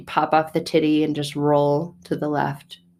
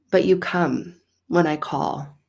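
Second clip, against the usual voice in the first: ordinary delivery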